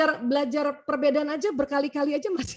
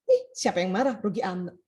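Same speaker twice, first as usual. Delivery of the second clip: scoffing denial; 6 semitones lower than usual. Retold or imitated. Wife scoffing at the question.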